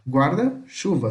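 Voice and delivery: Guarda, pause, chuva, as two words; split compound word. Shown as a demonstration. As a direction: slowly separate Guarda from chuva at the hyphen. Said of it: "ele tem um traço no meio".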